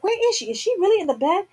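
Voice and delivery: put-on calling out in fear for her child; high alarmed questioning; frantic panic. Retold panic searching for daughter.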